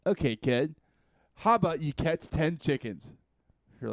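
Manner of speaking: put-on loud, patronizing old master. Drunk master sends the hero away again, loud and patronizing. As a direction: praise him like a lazy sensei stalling.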